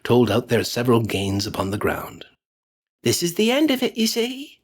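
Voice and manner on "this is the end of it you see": put-on higher gloating old woman's voice; gloating charwoman. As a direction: crow over the spoils. Charwoman gloats over the money.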